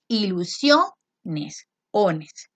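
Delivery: Ilusiones drawn out very slowly; slow stretched word. Shown as a demonstration. Teacher slowly sounding out Ilusiones for class. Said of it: "Fijarme cuál es la vocal que tiene el acento".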